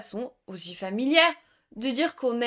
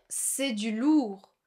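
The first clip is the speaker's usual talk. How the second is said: slow model phrase; 3 dB quieter, much slower than usual. Shown as a demonstration. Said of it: "tu peux dire".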